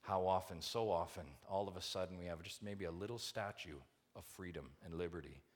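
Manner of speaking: ordinary delivery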